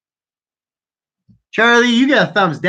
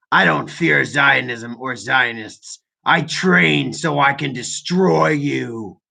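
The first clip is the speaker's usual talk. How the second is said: slow, low mock villain threat; low, deliberate, hammed-up threat; mock menacing; 4 semitones lower, slower than usual. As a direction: draw the words out with mock menace. Reading troll's threat aloud dramatically.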